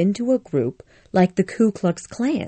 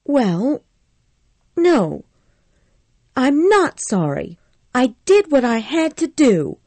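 put-on higher, pleading young woman's voice; upset Scarlett; 8 semitones higher than usual. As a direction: Scarlett fretting guiltily to Rhett, higher. Scarlett answering defiantly.

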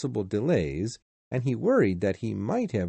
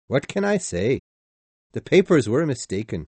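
shrugging conductor; 2 semitones higher, 5 dB louder than usual. Acted.

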